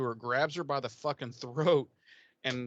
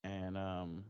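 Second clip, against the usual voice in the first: ordinary delivery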